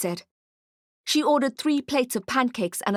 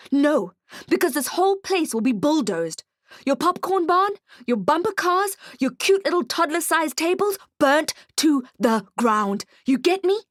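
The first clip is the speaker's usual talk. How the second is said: high, loud furious threat; angry threat; 7 semitones higher, 3 dB louder than usual. Acted. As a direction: rage at the goblin to scare her. Detective escalates threat.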